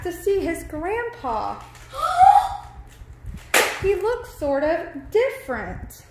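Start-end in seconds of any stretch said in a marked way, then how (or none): 1.9-3.8 high sharp gasp; surprised gasp. Acted. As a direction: gasp at the strange sight. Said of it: "went into the room and gasped".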